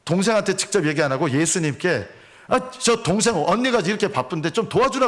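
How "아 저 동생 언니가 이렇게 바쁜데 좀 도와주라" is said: higher whiny complaint to Jesus; complaining Martha. Retold or imitated. Martha complaining to Jesus about her sister. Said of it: "예수님께 이제 불평을 쏟아놓습니다".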